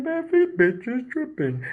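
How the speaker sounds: high, slow cartoon-dog grumble; Dopey Dog. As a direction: imitate a dopey dog complaining, high and drawn out. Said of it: "he sounds kinda like, uh, uh, Dopey Dog".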